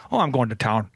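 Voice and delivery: low, easy-going Minnesotan oh; lightly voiced Minnesotan local; Minnesotan accent. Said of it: "He would say".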